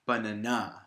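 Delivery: "banana" said as buh-nuh-NAH; stress on last syllable. Shown as a demonstration. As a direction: land hard on the final syllable of "banana". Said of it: "put the stress on the last syllable".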